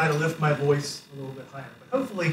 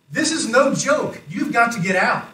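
urgent warning; 6 semitones higher, 7 dB louder than usual. Retold or imitated. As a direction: voice a guard urgently ordering people out. Guard urging guests to flee collapse.

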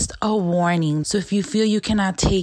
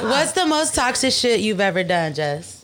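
ordinary delivery

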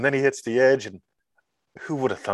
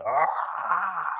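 drawn-out, higher-pitched mock groan of overwhelm; exasperated "aah"; 7 semitones higher than usual. Rational brain overwhelmed by the money question, exasperated. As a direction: let out a long, torn "aah". Torn mind gives up, exasperated.

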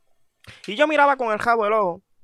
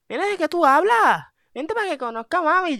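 put-on high, louder insistent girlfriend; girlfriend's voice; 9 semitones higher, 3 dB louder than usual. Girlfriend brushing off boyfriend's excuse, high and insistent. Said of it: "me dice".

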